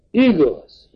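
high, loud shocked exclamation of Igaz; uncle cries out. A narrator's reading. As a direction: throw Igaz out as a startled cry. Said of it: "kiáltott fel a nagybácsi".

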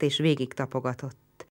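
usual speaking voice

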